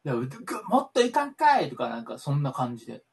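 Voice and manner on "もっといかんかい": 凄むセコンド. Retold or imitated. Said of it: "めっちゃ怖いやん".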